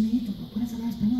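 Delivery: high, quick, soft grandma voice; pleading abuela. Retold or imitated. Grandma asking grandchild for Spanish, high quick plea. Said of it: "She said".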